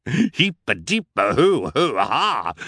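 mock villain cheer; gleeful shout. A narrator's reading. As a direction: whoop it out triumphantly. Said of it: "Olaf shouted".

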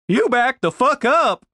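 fierce shout, high and loud. A narrator's reading. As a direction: yell it to back them off. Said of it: "shouted Denise".

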